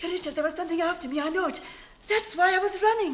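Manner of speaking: high, fast frightened babble; rushing out what chased her; wife telling husband something chased her. Acted.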